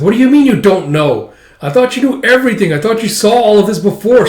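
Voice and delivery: high, loud, incredulous challenge; retold outraged rant; acted exasperation. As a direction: berate someone who should have known. Retold angry challenge to know-it-all.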